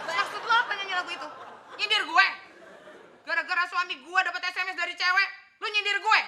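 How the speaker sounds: indignant, loud confrontation; marah-marah. Acted. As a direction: fire accusing questions with hard bursts. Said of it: "udah jangan marah-marah dulu". (marah tersinggung, menuduh dengan suara keras)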